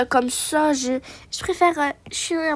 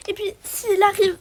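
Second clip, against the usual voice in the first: ordinary delivery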